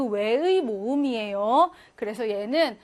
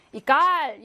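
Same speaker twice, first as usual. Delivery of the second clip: 이 깔 with a loud, weighty 깔; stress on second syllable; 5 dB louder, much slower than usual. Shown as a demonstration. Teacher shows a loanword's back stress, 이 깔 as i-GAL. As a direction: punch the 깔 in 이 깔. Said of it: "강세가 뒤에 있습니다".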